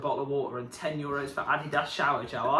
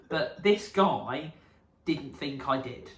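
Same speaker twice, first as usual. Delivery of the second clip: ordinary talk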